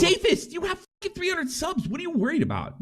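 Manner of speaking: high, disbelieving outburst; incredulous. Real, not acted. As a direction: scoff in disbelief. Incredulous reaction to clip.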